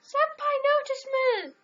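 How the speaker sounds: squealing high anime fangirl; overacted anime dub. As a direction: squeal for senpai to notice. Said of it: "Jag känner som en fjortis".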